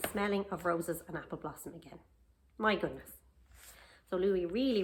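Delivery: usual speaking voice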